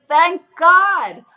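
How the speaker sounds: imitating a loud, high cry of relief; relieved scream. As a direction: shout it out like the weight just lifted. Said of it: "the dentist screamed".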